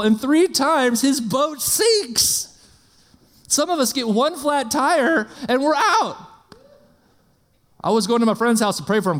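high, ringing preacher's build on Three times; rising sermon passion, voice lifted high; fiery preaching. Acted. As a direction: preach it out big to the crowd. Preaching at full force.

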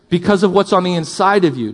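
ordinary talk, nothing marked

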